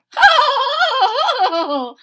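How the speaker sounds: high, loud burst of laughter; Fluffy laughing out loud at Hippo. Acted. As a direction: let it all out.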